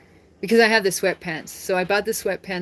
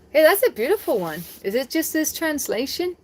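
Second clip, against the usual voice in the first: delighted surprise; 7 semitones higher than usual. Real, not acted. Moved by the verse.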